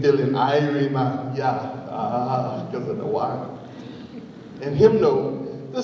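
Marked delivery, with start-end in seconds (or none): 0.0-3.5 low, soft, sated drawl; relaxed, lowered tipsy voice; tipsy contentment. Acted. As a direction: sink into lazy wine-fed satisfaction. Narrator mimics wine-sated snake.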